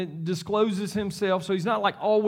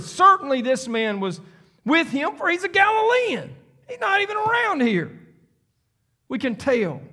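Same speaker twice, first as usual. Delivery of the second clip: insistent accuser; 10 semitones higher, 3 dB louder than usual. Acted. Another man in the courtyard accusing Peter, rising higher insistence. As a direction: pitch climbs much higher as the accusation builds.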